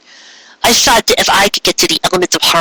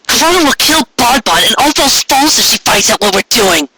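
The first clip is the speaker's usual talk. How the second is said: frightened objection; 7 semitones higher than usual. A narrator's reading. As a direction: warn them in panic. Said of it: "Lyra objected".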